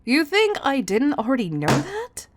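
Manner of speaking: high, loud incredulous scolding; indignant outburst. Acted. Boss rebuking the vampire employee's excuse, loud indignant outburst. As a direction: raise your voice and throw the question back.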